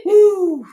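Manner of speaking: loud high whoop of hype; excited woo. Real, not acted. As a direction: shout a happy woo for a fan. Hyping a viewer who joined.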